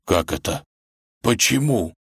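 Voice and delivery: small, bewildered questions; stunned babble. Acted. Wolf watches his friend fall, stunned babbling. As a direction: falter in mild shock. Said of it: "ошеломлённо пролепетал волк".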